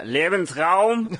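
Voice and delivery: put-on German accent. German word said with mock gusto.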